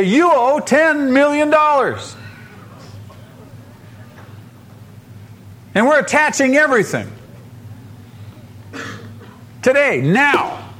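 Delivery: high, forceful tax agent's demand; stern demand. Acted. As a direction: deliver the debt like a booming ultimatum.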